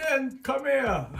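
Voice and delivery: high, loud, slow teacher's summons; mock calling out. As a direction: imitate a teacher calling a pupil over. Acting teacher calling him over.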